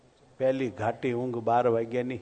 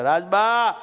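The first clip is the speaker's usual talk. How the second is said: high, louder shout of a name; calling out; 6 semitones higher, 4 dB louder, slower than usual. Acted. Brahmachari shouting for Rajba from the courtyard at night. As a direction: call across the courtyard. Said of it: "ફળિયામાં ઊભા રહીને બૂમ મારે".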